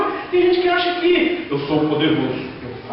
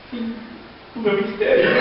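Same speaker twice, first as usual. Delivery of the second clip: laughing through a mock lament; mock despair, laughing; 4 dB quieter than usual. Preacher jokes his ministry just ended, laughing. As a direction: deflate the boast with a chuckle. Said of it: "E o irmãozinho dando testemunho".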